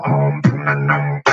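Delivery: low buzzing bass growl; vibration bass. Shown as a demonstration. Teaching a beatbox bass, low rumbling demo. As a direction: rumble it deep in the throat. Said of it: "vibration bass".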